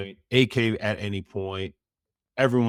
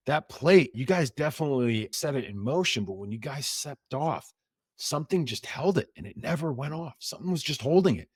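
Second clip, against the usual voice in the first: higher, wondering disbelief; amazed bomb tech; 6 semitones higher than usual. Retold or imitated. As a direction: marvel at it like you can't explain it. Bomb tech amazed it never exploded.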